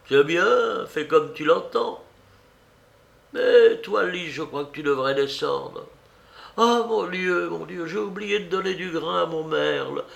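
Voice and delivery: put-on high-pitched, animated old lady; fussy old aunt. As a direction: exclaim as a flustered elderly aunt.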